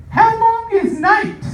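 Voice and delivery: put-on squeaky little kid, drawn out; a small child's odd question, slow and high. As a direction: blurt it like a tiny kid, pitch way up. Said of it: "the kid just blurted out".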